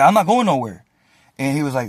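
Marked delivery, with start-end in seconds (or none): 0.0-0.8 retold refusal, higher and louder; firm refusal. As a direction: dig in and protest, voice raised. Acts out refusing the officer.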